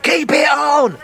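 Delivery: high, imitated audience shout; mock crowd heckle. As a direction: call out like a goading heckler. Audience heckles about fur hat.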